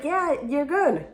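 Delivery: high, slow, easygoing clerk voice; clerk's reply. Retold or imitated. The clerk tells her she can go, high and slow. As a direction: play the clerk waving her off. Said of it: "she's like".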